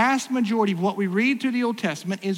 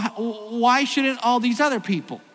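normal talking voice

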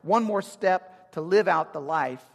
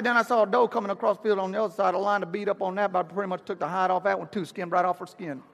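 put-on country accent; 3 semitones higher, faster than usual. Pastor voices country friend telling the graphic deer story, country accent. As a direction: rattle off the hunt in a country accent. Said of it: "I'll do a little bit of his accent".

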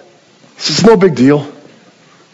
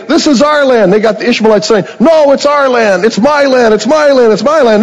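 mock quarrel claim; 7 semitones higher than usual. Rival tribes shout land claims.

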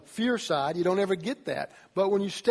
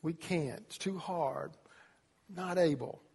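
whining; 7 dB quieter than usual. Retold or imitated. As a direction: sound like you're giving up. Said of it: "Whine about this".

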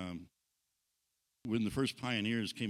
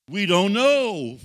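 slow, very loud drawn-out crowd reply; crowd shouting back; 7 semitones higher, 15 dB louder, much slower than usual. Retold or imitated. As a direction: bellow it back as the whole crowd. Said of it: "they shouted back".

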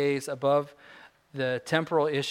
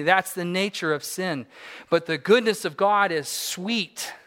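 raised, louder preacher's call to come home; fervent pulpit appeal, higher and louder; preaching at full force; 6 semitones higher, 7 dB louder than usual. Acted. Preaching climax on sin and goodness.

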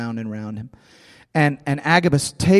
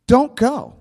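higher-pitched imitation of begging; pleading; 7 semitones higher, 3 dB louder than usual. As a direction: act out friends imploring him to stay. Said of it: "they're pleading and begging and weeping".

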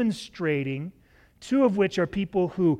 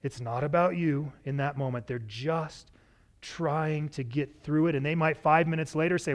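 ordinary talk, nothing marked

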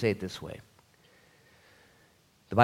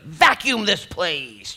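mock chore order; 7 semitones higher, 7 dB louder, much slower than usual. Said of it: "He could have showed up".

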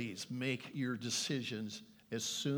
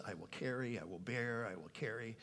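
soft emphatic recap; 2 semitones lower, 7 dB quieter than usual. Acted.

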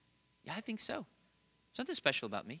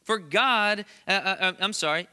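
ordinary talk

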